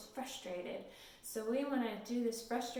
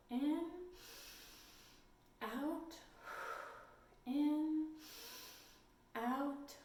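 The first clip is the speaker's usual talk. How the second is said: soft, slow calming breathing cues; guided breathing; 3 semitones higher, 5 dB quieter, much slower than usual. Shown as a demonstration. Teacher guiding children through calm breathing, soft and slow. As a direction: guide the kids' breaths gently in and out. Leads five finger breathing.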